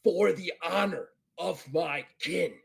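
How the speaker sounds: rising to loud, emphatic oath; vowing Klingon warrior. Acted. Klingon swearing revenge for kin's honor, forceful vow.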